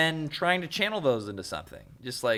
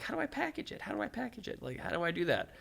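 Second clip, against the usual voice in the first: puzzled musing; 10 semitones higher, 9 dB quieter, faster than usual. Retold or imitated. Retold own puzzled thinking.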